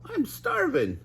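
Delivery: high whiny starving cry; high hungry Shaggy whine.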